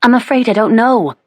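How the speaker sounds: a frightened maid stammering to the Duchess; trembling. A narrator's reading. Maid trembling before the Duchess, high and shaky. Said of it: "the poor maid answered, trembling".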